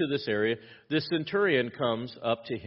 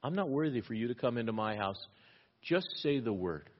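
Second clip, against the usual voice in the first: softer, humble, a little quick; humble plea; 2 semitones lower, 7 dB quieter than usual. Retold or imitated. Centurion humbly asking Jesus, soft and quiet. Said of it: "he humbles himself and he says".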